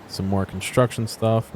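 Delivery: ordinary talk, nothing marked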